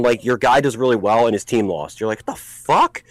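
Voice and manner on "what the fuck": bewildered. Retold or imitated. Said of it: "it's the weirdest feeling".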